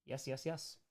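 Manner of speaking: ordinary talk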